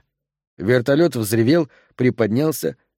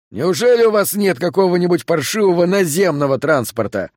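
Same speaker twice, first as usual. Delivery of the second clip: yelling over helicopter; 6 semitones higher than usual. A narrator's reading. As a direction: yell it over the roaring blades. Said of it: "проорал он сквозь шум лопастей".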